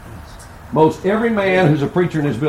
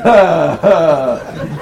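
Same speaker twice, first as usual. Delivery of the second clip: father's hearty laugh; 5 dB louder than usual. Retold or imitated. Father laughing at sick boy.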